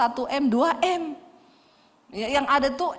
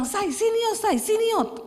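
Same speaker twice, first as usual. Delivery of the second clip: excited calling; 10 semitones higher than usual. Retold or imitated. Husband calling wife over excitedly.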